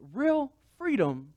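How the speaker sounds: real freedom, stretched high and slow; emphasized. Real, not acted. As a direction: lean hard on real freedom. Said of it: "let me emphasize that".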